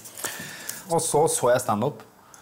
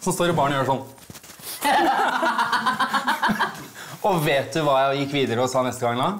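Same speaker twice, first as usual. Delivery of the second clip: ordinary talk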